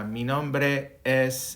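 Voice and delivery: slow "Mi nombre es" with the final S said clearly; teacher modelling "Mi nombre es" slowly; Spanish pronunciation demo. Shown as a demonstration. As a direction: stretch "nombre es" and sound the S. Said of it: "you need to pronounce the S".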